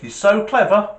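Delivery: loud, high jeer; acted taunt, thrown out hard; taunting challenge. Guy challenges the gorilla.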